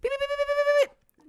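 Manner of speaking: very high, loud rapid-fire beeps; frantic beeping. Acted. Wife mimicking husband's monitor going off, frantic high beeping. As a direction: imitate an alarm going wild. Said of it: "and his goes beep".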